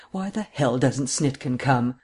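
plain reading voice

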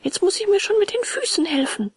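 high, exasperated puppet voice; high-pitched, mild anger; growing anger. A narrator's reading. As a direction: resolve it crossly in a high voice. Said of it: "immer mehr vom Zorn erfasst".

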